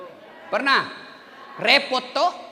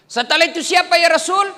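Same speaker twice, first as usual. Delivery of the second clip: eager question; 10 semitones higher, 7 dB louder than usual. Acted. Questioner voiced loudly.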